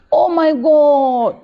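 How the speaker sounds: high, slow, stunned cry; shocked gasp. Acted. Relative at the deathbed, crying out in shock. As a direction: let the shock break out.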